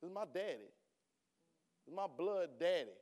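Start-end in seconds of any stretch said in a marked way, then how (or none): none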